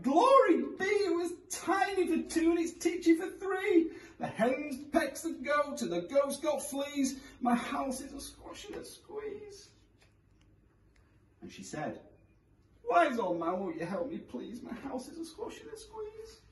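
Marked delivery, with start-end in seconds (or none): 0.0-9.7 put-on high, fretful old woman; crying old lady. A narrator's reading. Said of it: "The little old lady cried". 12.9-16.4 high, fading plea; pleading old lady. A narrator's reading.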